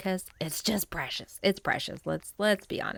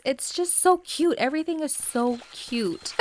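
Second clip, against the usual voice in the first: gushing; 7 semitones higher, 4 dB louder than usual. Real, not acted. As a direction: melt over how cute it is. Gushing over cute shoes.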